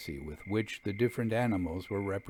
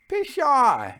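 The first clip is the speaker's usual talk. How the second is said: sharp high dismissive Pshaw, pitched up and loud; scornful scoff; 7 dB louder than usual. A narrator's reading. Jasper brushing off his friend's doubt, scornful scoff. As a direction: scoff it off, higher and louder.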